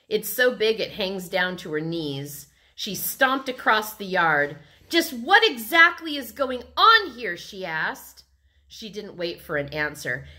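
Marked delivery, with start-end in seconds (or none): 4.9-7.4 high, loud indignant question; irate demand. A narrator's reading. Libby confronts the kids in the yard, irate. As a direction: demand an explanation from noisy kids.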